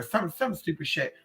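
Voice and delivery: usual speaking voice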